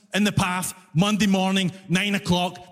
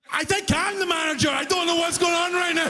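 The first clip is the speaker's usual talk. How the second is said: bewildered shout; 8 semitones higher than usual. Retold or imitated. Cashier to customer asking for manager, high panicky shout. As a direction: shout it, totally out of your depth. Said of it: "And I'm like".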